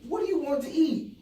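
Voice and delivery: high-pitched, loud put-on asking; eager mock question. Husband asking hungry wife about food, high mock-eager. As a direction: pitch it way up, playfully over-eager.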